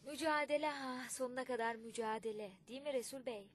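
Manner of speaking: loud, rousing call to struggle; loud exhortation. A narrator's reading. Kaymakam exhorting loudly. (yüksek sesle, coşkulu çağrı)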